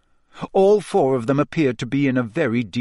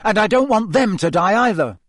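higher, louder desperate plea; yelling; 7 semitones higher, 4 dB louder than usual. Acted. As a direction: cry out in desperation. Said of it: "Harry yelled".

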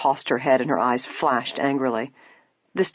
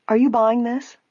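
ordinary delivery, nothing marked

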